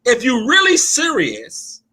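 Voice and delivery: preaching. Acted. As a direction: preach it to the congregation. Preaching to the congregation.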